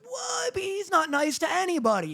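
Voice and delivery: high, hammed-up disbelief from the crowd; mock shocked classmates. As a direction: play the gossiping classmates who can't believe it. Retelling classmates' shocked reaction.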